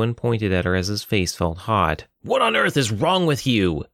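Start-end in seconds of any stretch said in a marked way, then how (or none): 2.2-3.9 father's high, swooping outburst; acted angry scolding, pitched up; furious demand. A narrator's reading. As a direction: demand an answer from your child. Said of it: "he demanded".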